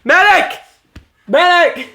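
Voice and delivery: high yell for a medic; man down shout. Pretend. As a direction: call for help. Casualty on set call.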